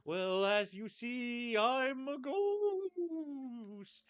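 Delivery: ghost voice. Acted. Voicing a ghost character.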